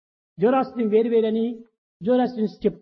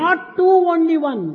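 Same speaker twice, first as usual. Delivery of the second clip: "Not two, only one" pitched high with firm stress; strong emphasis; 5 semitones higher, much slower than usual. Acted. Preacher declaring what Vedanta teaches, strongly emphatic "Not two, only one". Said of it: "with such a strong emphasis and stress".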